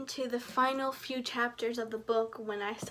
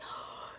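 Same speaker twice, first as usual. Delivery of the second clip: shocked gasp; 13 dB quieter than usual. Retold or imitated. Shocked reading Jason's orders.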